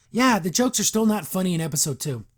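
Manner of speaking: normal talking voice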